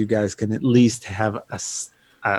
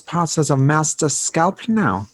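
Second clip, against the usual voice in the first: higher-pitched mock German accent; 4 semitones higher than usual. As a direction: put on a hammy German accent. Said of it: "you can do that accent".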